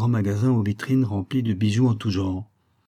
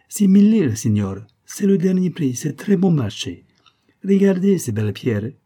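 high, loud Italian vendor; Italian accent; 9 semitones higher, 7 dB louder than usual. A narrator's reading. Shopkeeper naming his price to tourist, Italian accent. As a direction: push the bargain in an Italian accent. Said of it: "L'Italien prit le collier en devanture".

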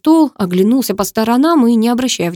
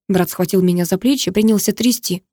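plain reading voice

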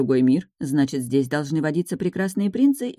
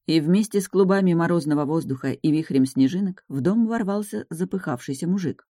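ordinary delivery, nothing marked

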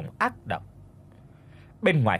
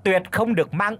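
harsh callback; 7 semitones higher than usual. Acted. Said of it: "gọi giật giọng lại".

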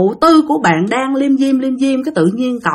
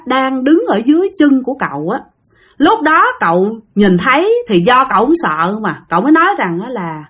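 ordinary delivery

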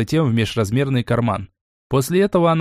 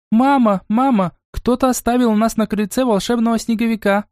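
put-on little girl shouting; 10 semitones higher than usual. Little girl runs in shouting to her mum. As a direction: burst in calling for mum. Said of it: "прокричала младшая девочка".

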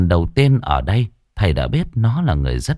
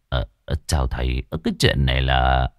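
put-on hesitant old man; 8 semitones lower, 4 dB quieter than usual. Old medium hesitant greeting.

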